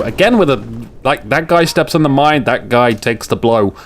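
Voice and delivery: ordinary delivery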